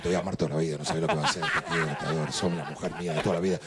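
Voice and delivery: very low, monotone seducer's drone; hypnotizer sweet-talk. Acted. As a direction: lull her with a deep, unbroken murmur of vows. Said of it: "éramos hipnotizadores".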